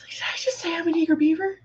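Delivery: high, quick, hushed disbelief; mortified whisper. Retold or imitated. Friend re-enacts regretting own words, mortified whisper. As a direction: whisper it fast in mock horror.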